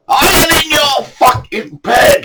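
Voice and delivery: high, slow, shouted denial; high drawn-out yell of denial; furious yell. Retold or imitated. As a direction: roar it back in rage. Quarrel, other angler shouting back.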